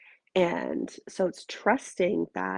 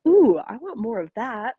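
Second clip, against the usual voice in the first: eager delight; 7 dB louder than usual. Acted. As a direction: play him keenly wanting more. Voices husband's delighted reaction.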